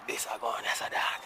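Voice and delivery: soft unvoiced whisper. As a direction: whisper it like a secret. Same words repeated whispered.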